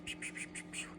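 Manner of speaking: very soft, voiceless pew-pews; a quick run of tiny whispered zaps; imitated firing noises. Vlogger showing viewers how nerve pain fires, imitated zaps.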